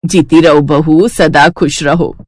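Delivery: put-on slow, low elderly blessing; old woman's blessing. Mother-in-law blessing Shalu after the win, slow and low. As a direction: bless her slowly like a grandmother.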